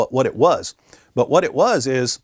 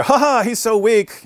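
high, slow sneering laugh line; slow, higher mock sneer of a smug onlooker; 9 semitones higher, slower than usual. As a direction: scoff at the weak debater. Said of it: "Muslims would walk out".

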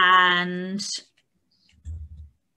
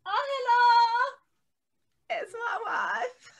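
tearful; much slower than usual. Real, not acted. Greeting her wife through tears, slow and high.

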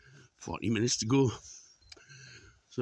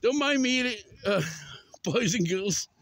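high, raised greeting voice; calling to animals; 10 semitones higher, 4 dB louder than usual. Real, not acted. Walker tells animals not to mind, high call. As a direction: address them in a high voice.

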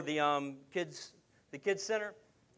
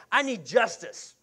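raised, drawn-out mock-indignant demand; mock angry demand; 5 semitones higher, 6 dB louder, slower than usual. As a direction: demand it loudly, imitating an upset mother. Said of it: "and she is mad".

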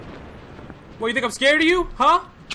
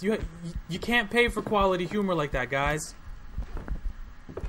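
ordinary talk, nothing marked

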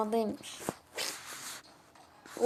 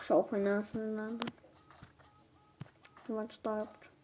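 subdued annoyance; 3 semitones lower, 6 dB quieter than usual. Real, not acted. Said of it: "sinirlendim ben bugün".

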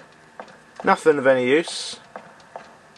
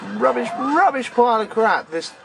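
raised pitch, climbing on the repeats; exasperated rant; 6 semitones higher than usual. Real, not acted. Player slating the theme tune, exasperated high rant. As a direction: pile up the repeated insult, higher as it goes.

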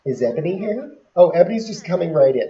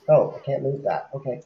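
ordinary delivery

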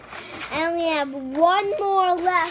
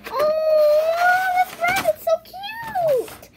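much higher and louder, slowed adoring coo; friendly cute gush; 6 dB louder, much slower than usual. Acted. Child showing friendly cute, high adoring coo. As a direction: coo over a sweet friend. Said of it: "Not that kind of cute".

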